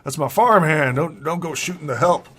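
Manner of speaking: put-on flustered old farmer calling for calm; alarmed farmer. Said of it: "And Farmer Ed's like".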